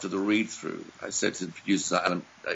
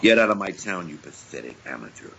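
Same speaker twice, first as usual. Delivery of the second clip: villain's dismissal; 5 semitones lower than usual. Acted. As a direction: send a rival packing, dropping to a menacing hush. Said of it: "When I told him to get out of town".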